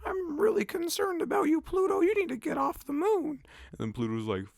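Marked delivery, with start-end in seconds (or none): 0.0-3.4 high squeaky mock-mother voice; mock mom. Vlogger mocking the mom scolding Pluto, high squeaky voice. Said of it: "she's like".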